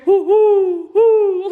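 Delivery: loud, high imitated owl hoots. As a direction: hoot loudly like an owl at night. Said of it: "So when he would hoo".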